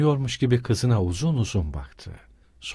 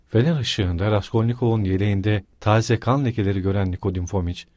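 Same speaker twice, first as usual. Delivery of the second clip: usual speaking voice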